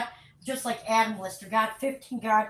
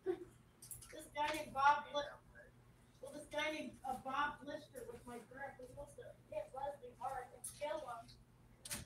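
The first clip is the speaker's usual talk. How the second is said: very soft, almost inaudible; barely voiced appeal for help; hushed confiding; 20 dB quieter than usual. Real, not acted. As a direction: tell it quietly, haltingly. Quiet confiding appeal about threat.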